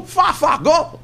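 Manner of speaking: high, laughing far far go; mocking the locals. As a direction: tease the vague answer, chuckling. Locals' answer, mocked with laughter.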